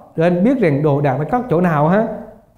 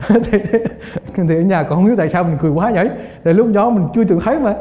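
ordinary talk

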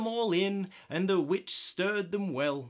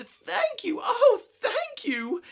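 high, loud relieved thanks; grateful cry; 8 dB louder than usual. Acted. Grateful witch thanks her rescuers, high loud cry. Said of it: "the grateful witch cried".

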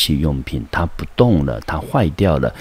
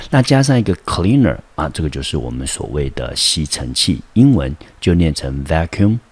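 ordinary talk

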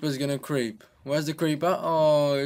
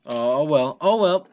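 loud exasperation; 2 semitones higher, 9 dB louder than usual. Real, not acted. Gamer fails a jump.

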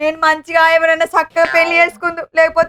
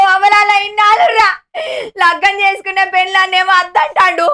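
crying shout; wailing. Acted. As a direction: wail at him in anger and tears. Wife wails at husband. (ఏడుస్తూ అరవడం)

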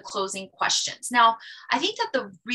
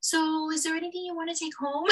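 higher, softer, hesitant asking; awkward mock question; 6 semitones higher, 6 dB quieter than usual. Mock awkward hesitant question. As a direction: consultant awkwardly asks a family member to buy. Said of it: "awkward situation of asking a family member".

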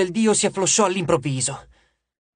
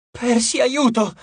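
a slow, much higher cry for help; a drawn-out, higher plea for help; calling for help; 7 semitones higher, slower than usual. A narrator's reading. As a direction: cry out to Percy in fear. Piper calls for help in terror.

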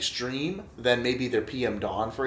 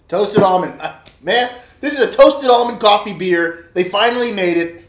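much higher and louder, bursting with delight; real excitement; 8 semitones higher, 10 dB louder than usual. Real, not acted. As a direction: exclaim it like you just found your favorite flavor. Thrilled at the toasted almond flavor.

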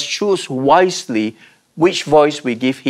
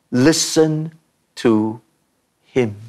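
"Listen ... to ... him", a pause after each word; word-by-word emphasis; 3 semitones lower, slower than usual. Real, not acted. Preacher telling what we need to hear, word by word, solemn. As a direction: step down in pitch on "to" and "him".

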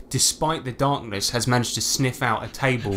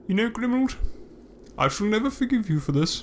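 high, hurt little voice; wounded creature; 9 semitones higher, faster than usual. Acted. Owen tearfully reproaching Grimald, high and hurt. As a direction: sulk through a lone tear. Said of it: "and he goes".

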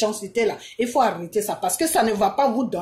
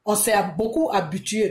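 ordinary talk

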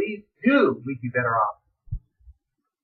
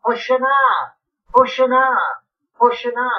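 crowd acclaim; 5 semitones higher, 5 dB louder than usual. Acted. Crowd hails the king.

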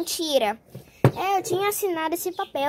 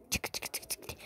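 pen clicks; 18 dB quieter than usual. Acted.

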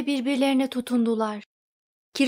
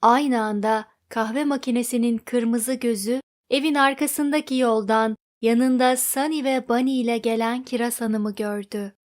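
plain reading voice

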